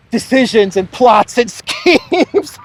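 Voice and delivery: high voice breaking into laughter; laughing while speaking. Real, not acted. Commentator ridiculing Biden's followers, cracking up mid-sentence. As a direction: stretch the words out as laughter takes over.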